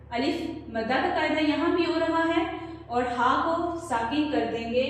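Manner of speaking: ordinary talk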